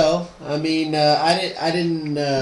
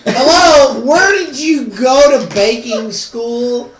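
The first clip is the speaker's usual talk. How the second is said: mocking disbelief; 6 semitones higher, 5 dB louder, slower than usual. Real, not acted. Mocking the baker.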